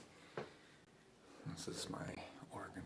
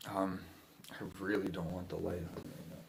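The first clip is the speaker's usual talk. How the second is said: normal talking voice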